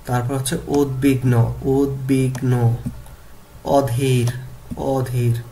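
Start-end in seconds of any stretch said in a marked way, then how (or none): 0.7-2.8 উদ্বিগ্ন modelled as ud-big-no, then stretched slowly; pronunciation drill. Shown as a demonstration. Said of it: "উচ্চারণ করতে হবে এইভাবে". 3.6-5.4 অধীর said carefully as o-dhir; pronunciation drill. Shown as a demonstration. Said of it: "উচ্চারণ করতে হবে এইভাবে".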